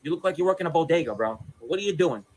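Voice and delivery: normal talking voice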